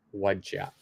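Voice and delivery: "What'd ya" said as wudja, short and soft; casual native "What'd ya"; blended "what'd ya". Shown as a demonstration. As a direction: squash "What'd ya" into two quick syllables. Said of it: "This kind of becomes like".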